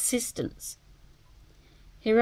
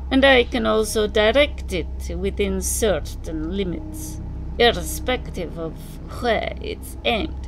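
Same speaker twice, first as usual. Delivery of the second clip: put-on Slavic accent; 3 semitones higher, 7 dB louder than usual. A narrator's reading. Narrator voices the Russian.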